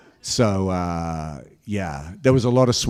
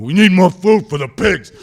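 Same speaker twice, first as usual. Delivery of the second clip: loud, higher call for more pig food; urgent demand; 9 semitones higher, 10 dB louder than usual. Retold or imitated. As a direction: chop away while demanding food. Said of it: "looking around going".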